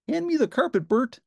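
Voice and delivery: high, bright puppet voice for Ernie. A narrator's reading. Ernie teasing Bert, high cheerful puppet voice. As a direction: play the giddy Ernie.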